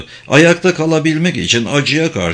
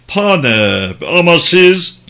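astonished greeting that jumps up louder; taken aback, rising to a louder question; startled surprise; 5 semitones higher, 4 dB louder, slower than usual. Acted. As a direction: break off the name, then exclaim in surprise. General startled by visitor's state.

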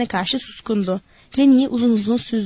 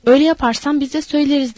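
ordinary delivery, nothing marked

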